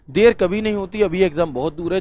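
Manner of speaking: normal talking voice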